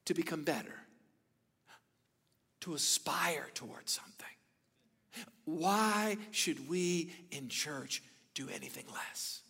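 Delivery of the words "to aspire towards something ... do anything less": whisper. Real, not acted. Preacher whispering a challenge to the church.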